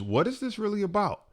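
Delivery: "what is this really about" pitched high throughout; high questioning. Shown as a demonstration. Speaker posing his first question, "what is this really about" pitched high. Question posed, then repeated slowly.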